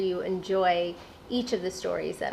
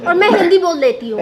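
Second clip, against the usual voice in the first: laughing; 10 semitones higher, 14 dB louder, much faster than usual. Real, not acted. Playful quip, laughing reply.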